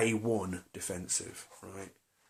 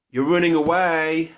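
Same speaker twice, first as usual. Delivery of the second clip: louder, higher accusing jab on running; father's retort; 5 semitones higher, 9 dB louder than usual. Retold or imitated. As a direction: throw it at him like his father would. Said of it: "something that my father would say".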